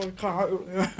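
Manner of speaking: softly, "huilen" with a catch in the voice, close to tears; mock about to cry, hushed; half-joking tearful. (half-gespeeld huilerig, zacht)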